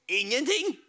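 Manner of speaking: ordinary delivery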